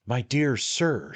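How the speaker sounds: slow, high, ingratiating lawyer; a hint of a fussy little attorney; little attorney, confiding. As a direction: fuss over him, dear sir. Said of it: "said the little man in a low tone".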